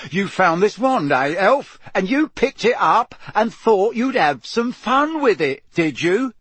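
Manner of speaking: loud, high, hard interrogation; Mister Diggory. A narrator's reading. Mister Diggory turns on the cowering elf, hard accusation. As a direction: pounce on each phrase accusingly. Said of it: "his eyes hardening".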